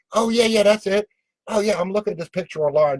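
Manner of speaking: high-pitched chatty enthusiasm; acted eager recognition. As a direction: pipe up eagerly, as if just spotting it. Acted delight at spotting the house.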